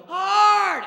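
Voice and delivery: very high, very loud yell; shouted call. Retold or imitated. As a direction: sweepers hollering it back. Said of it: "They'll yell, they'll go".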